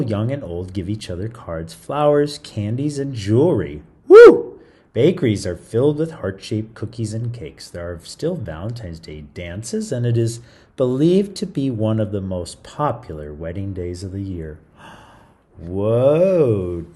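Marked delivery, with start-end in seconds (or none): 4.1-4.6 high, loud whoop; loud cheer. Real, not acted. Excited cheer. 14.8-16.9 mock gasp then whoa; gasp of surprise. Reader gasping in mock amazement at wedding days.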